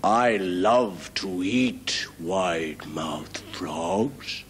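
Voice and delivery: low, very slow, relishing growl; menacing crocodile. Acted. Crocodile telling the frog what it eats, low and slow. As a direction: savour every word like a meal.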